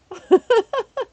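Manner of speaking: sudden high burst of laughter; a real squeal of a laugh; loud delighted laugh. Real, not acted.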